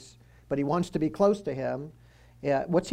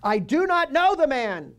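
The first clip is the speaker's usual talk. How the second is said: loud, high, swearing denial; cursing denial; 10 semitones higher, 6 dB louder than usual. Acted. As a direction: spit it out like a curse. Began to curse and swear.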